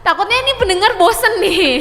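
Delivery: high, loud laughing complaint. Real, not acted. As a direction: laugh while protesting. Laughing complaint about [name].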